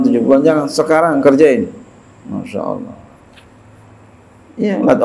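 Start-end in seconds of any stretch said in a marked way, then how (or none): 2.3-3.0 low soft Masya Allah, pitched well down; a sigh of amazement, sunk low and soft; hushed awe. Acted. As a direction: breathe it out in wonder.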